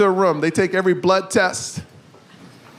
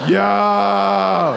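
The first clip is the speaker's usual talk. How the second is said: outraged yo. Retold or imitated. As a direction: belt out the disbelief. Reacting to the doctor.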